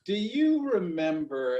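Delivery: ordinary delivery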